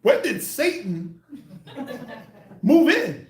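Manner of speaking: high, loud, slow laughing shock; laughing mock alarm. Mocking a husband hearing her growl, laughing. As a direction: crack up while asking. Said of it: "you like".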